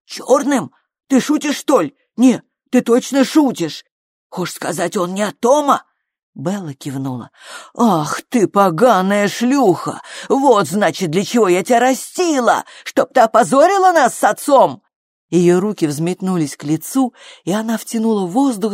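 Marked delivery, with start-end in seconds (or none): 0.0-5.8 higher, incredulous questioning; horrified disbelief. A narrator's reading. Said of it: "с ужасом уставилась на неё". 7.7-14.8 higher, loud outraged tirade; furious scolding. A narrator's reading. Mother cursing daughter who shamed the family, furious scolding.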